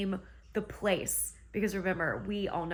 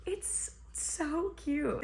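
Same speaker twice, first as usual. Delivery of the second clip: slow, breathy, high whisper of delight; gushing whisper; 7 semitones higher, 3 dB quieter, much slower than usual. Real, not acted. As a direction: whisper it slowly, as if too adorable to say aloud. Repeats the line as a whisper.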